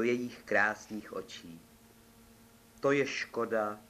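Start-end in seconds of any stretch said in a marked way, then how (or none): none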